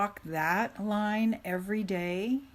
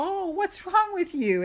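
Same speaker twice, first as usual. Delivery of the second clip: mock concern; 11 semitones higher, 5 dB louder than usual. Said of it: "people go".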